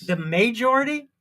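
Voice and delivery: MAJORITY as MAY-jority, rising in disbelief; mocking mispronunciation. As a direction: echo MAJORITY with a drawn first syllable, questioning.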